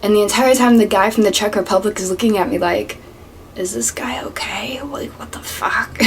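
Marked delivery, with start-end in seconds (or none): 3.5-6.0 hushed, disbelieving whisper; incredulous whisper. Retold or imitated. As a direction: whisper it like a secret aside. Coworker's silent look, voiced by teller.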